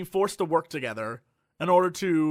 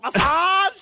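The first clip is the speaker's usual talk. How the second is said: much higher, louder "Massage!"; loud massage lady; 13 semitones higher, 7 dB louder than usual. Retold or imitated. Imitating the loud massage lady to a friend, high yell. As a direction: belt it like a mall vendor. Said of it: "she was so loud".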